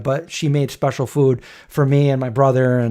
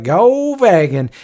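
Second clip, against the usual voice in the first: smart-aleck; 4 semitones higher, much slower than usual. Retold or imitated. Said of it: "being a smart ass all the time".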